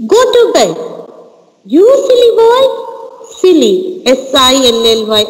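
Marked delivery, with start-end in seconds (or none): 0.1-2.6 high-pitched storybook scolding; acted cross mother; mother shouting. A narrator's reading. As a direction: raise the pitch sharply to scold a child. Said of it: "So mother is shouting".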